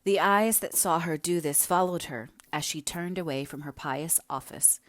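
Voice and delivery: ordinary delivery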